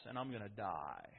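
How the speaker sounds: almost a whisper of promise; hushed foretelling. Acted. Jesus telling disciples of death and rising, hushed.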